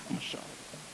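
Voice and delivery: voiceless awed whisper; a whispered aside of wonder; hushed whisper. Real, not acted. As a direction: breathe it out in a whisper.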